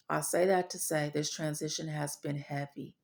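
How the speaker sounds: usual speaking voice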